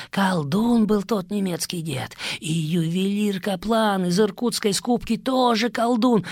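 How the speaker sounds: high, feverish rambling prisoner; rambling addict. Acted. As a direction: confess in a frantic babble. Prisoner raves about sorcerers.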